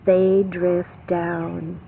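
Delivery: slow drift. Acted. Slow reading of falling leaves.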